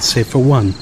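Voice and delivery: ordinary delivery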